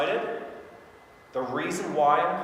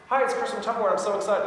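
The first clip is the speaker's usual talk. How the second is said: high, lifted, eager hello; excited greeting; 7 semitones higher, 4 dB louder than usual. Shown as a demonstration. Seller makes client wonder what it is about, excited. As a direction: lead brightly into being excited. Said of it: "Excited about what?".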